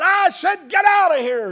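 wife screaming them off her doorstep; imitated furious scream. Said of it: "And she said".

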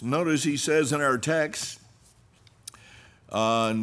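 ordinary talk

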